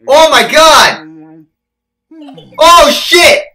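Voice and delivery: loud cries of alarm; startled yell. Real, not acted. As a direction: shout it as if jumped at. Jump scare in horror game.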